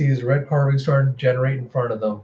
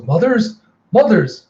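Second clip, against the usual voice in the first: high, loud frantic calls; calling out; 8 semitones higher, 5 dB louder, slower than usual. Acted. Mother shouts for her sisters in alarm. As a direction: yell for them in rising panic.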